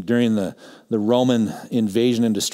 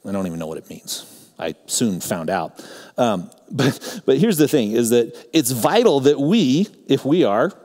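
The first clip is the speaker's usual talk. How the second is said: ordinary talk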